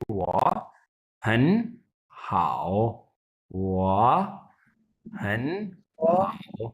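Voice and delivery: वा and हन in rising second tone, हाओ in dipping third tone; tone sandhi demo. Shown as a demonstration. Teacher showing students the three-third-tone rule, word by word. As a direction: read वा हन हाओ word by word with the tone change. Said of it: "इसलिए हम पढ़ेंगे इसे".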